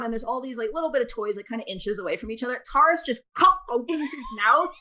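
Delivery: ordinary talk, nothing marked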